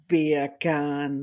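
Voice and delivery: "beer can" in a light Jamaican accent, low. Shown as a demonstration. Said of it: "that's how you can do a Jamaican accent".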